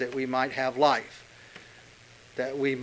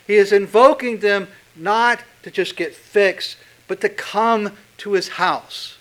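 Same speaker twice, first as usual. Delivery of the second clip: rising preaching fervor; 5 semitones higher, 4 dB louder than usual. Real, not acted. Sermon climax building up.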